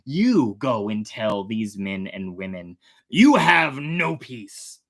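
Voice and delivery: God reading out a stern sentence of no peace. Acted. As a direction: pronounce judgment like the Lord himself. Reads God rebuking the watchers.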